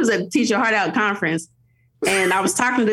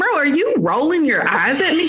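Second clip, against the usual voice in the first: higher, mock-indignant challenge; incredulous mom; 7 semitones higher, 3 dB louder than usual. Mom to eye-rolling toddler, mock indignation. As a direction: call out the eye roll, amused.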